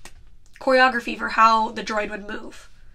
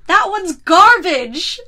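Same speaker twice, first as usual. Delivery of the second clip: dismissive mock quote; 6 semitones higher, 8 dB louder, much slower than usual.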